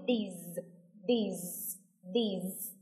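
"these" repeated low and softly with a Z ending; low, spaced models of "these"; buzzing final Z. Shown as a demonstration. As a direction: end each "these" on a held Z. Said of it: "Nhớ là có âm Z ở phía cuối".

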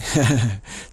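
brief laugh breaking into the sentence; short laugh. Real, not acted. Speaker admitting it's alarming, with a short laugh.

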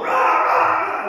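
loud, drawn-out mock crowd roar; imitated roar of a riled-up mob; mob chanting. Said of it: "why they were chanting".

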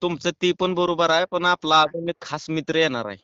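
normal talking voice

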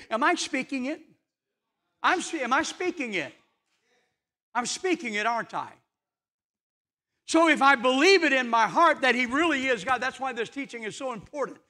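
high, earnest pulpit voice; preaching. Acted. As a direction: press it home to the congregation.

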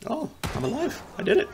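high, squeaky-pitched disbelief; amazed relief. Real, not acted. Player finding they are alive after all, amazed relief. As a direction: voice leaping up in astonishment. Relieved at surviving against odds.

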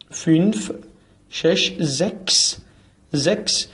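normal talking voice

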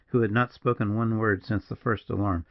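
ordinary delivery, nothing marked

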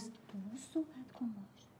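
urgent hushed whisper. Acted. Colleague whispering a warning during a phone call. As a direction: hiss it under your breath so the caller won't hear.

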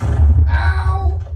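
high loud yelp of pain. Real, not acted. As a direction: yelp as if hit hard. Hit by ghast fireball.

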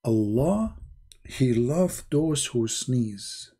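slow, hushed solemn quote; solemn preacher voice. Acted. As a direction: intone it like a grave sermon.

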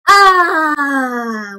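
a drawn-out contented "Ah"; the catchphrase "Ah", said for the class; relaxed "Ah". Acted. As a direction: let out a happy couch sigh. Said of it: "Try that again one more time".

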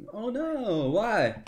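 high, loud oh no; loud distressed why; dismayed outcry. Real, not acted. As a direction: wail at the screen in dismay. Game mistake dismays him.

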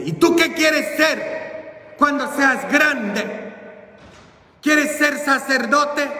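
high, sing-out questioning; bishop to child. Acted. Bishop lifts a small boy and asks his future, grand questioning. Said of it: "me levantó en sus brazos y me dice".

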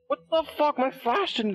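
high, shocked what the fuck; shocked outburst. Real, not acted. Gamer shocked when his flash fails. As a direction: gasp it out in disbelief.

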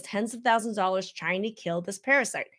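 ordinary talk

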